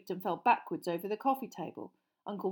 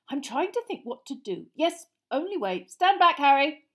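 higher voice, building to a warning; distracted father; 7 semitones higher, 9 dB louder than usual. Mister Weasley planning to break through, distracted then urgent. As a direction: think aloud, then call a warning.

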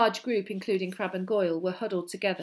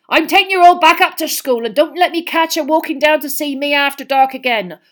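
loud, raised angry scolding; Hagrid, angry; 9 semitones higher, 15 dB louder than usual. A narrator's reading. Gamekeeper sends children back to school, angry. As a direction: scold them at full voice. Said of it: "Hagrid said angrily".

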